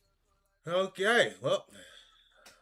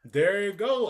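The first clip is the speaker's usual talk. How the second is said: ordinary delivery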